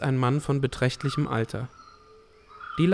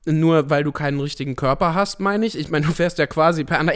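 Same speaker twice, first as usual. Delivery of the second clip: ordinary talk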